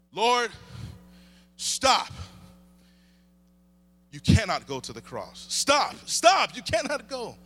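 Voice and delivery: loud, broken-up pleading commands; urgent protest. Acted. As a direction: burst out to stop him, with pauses between cries. Acts Peter protesting to Jesus.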